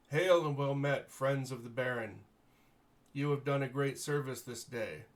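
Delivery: loud formal herald hailing the party; herald's greeting. Acted. Herald Munfred greeting the heroes before the court, loud and formal. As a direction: announce the heroes proudly to the court.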